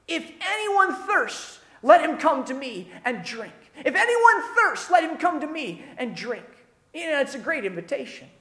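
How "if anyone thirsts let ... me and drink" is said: calling out. Retold or imitated. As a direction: cry it out as an invitation to a festival crowd. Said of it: "he stands up and he yells out".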